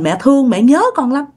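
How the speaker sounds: ordinary talk, nothing marked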